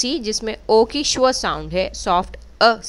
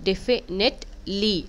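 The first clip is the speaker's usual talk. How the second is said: slow syllable read; much slower than usual. Shown as a demonstration. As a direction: definitely read slowly, syllable by syllable.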